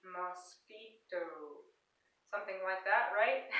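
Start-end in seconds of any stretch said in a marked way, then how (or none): 0.0-1.2 put-on slow, each syllable of "mosquito" spelled out for children. Shown as a demonstration. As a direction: stretch "mosquito" to count its syllables. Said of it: "We have three".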